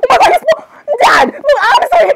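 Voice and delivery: shrieking high and loud in shock; panicked scream. Acted. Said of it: "You screamed way too loud".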